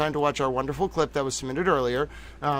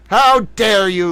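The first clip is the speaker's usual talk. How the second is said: higher, louder, slow faux indignation; mock outrage; 4 semitones higher, 7 dB louder, slower than usual. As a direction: ham up being offended. Mock offense.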